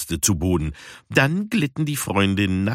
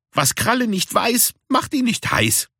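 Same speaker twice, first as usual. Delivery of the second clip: much higher, mischievous rhyme; cheeky cat girl. Acted. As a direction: tease the rule away. Sushi cheeky proverb. (viel höher, schelmisch)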